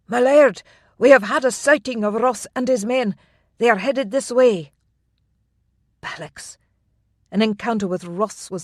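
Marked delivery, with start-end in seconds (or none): 0.1-4.7 loud, breathless alarm; urgent. A narrator's reading. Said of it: "burst in out of breath". 6.0-6.6 whispered curse. A narrator's reading. Inner curse.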